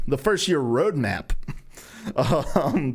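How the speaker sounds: ordinary talk, nothing marked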